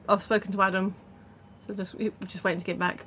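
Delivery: usual speaking voice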